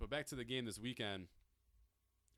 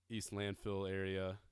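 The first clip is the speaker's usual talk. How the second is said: ordinary delivery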